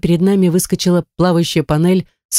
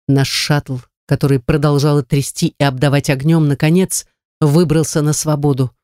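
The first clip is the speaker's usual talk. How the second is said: plain reading voice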